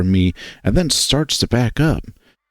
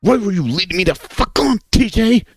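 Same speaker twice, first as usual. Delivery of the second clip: angry cry with breaking voice; voice breaking, rising; 9 semitones higher than usual. Acted. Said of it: "voice break as it rises in volume".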